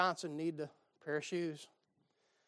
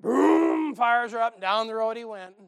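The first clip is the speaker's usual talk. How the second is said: louder, higher, bursting with joy; triumphant climax; 3 semitones higher, 8 dB louder than usual. Acted. Preacher telling how the Buick finally roared off, exultant. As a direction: let the story's payoff ring out. Climax of story, car starts.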